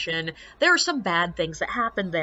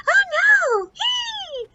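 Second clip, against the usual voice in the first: very high, squeaky hammed-up fright; mock shriek; 5 dB louder, much slower than usual. Girls huddled against the building, squeaky mock shriek. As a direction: shriek like a spooked little girl.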